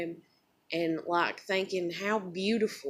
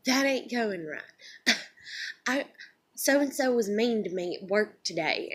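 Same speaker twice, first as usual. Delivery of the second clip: imitating mom; 5 semitones higher, 3 dB louder than usual. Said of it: "she would just be like".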